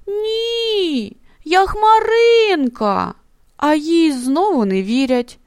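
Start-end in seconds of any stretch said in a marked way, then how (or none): 0.1-3.1 high, hurt voice close to crying; high and tearful, slower, hurt; on the verge of tears. A narrator's reading. The offended cloud insists on her name to the disbelieving animals, close to tears. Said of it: "від образи вона заплакала".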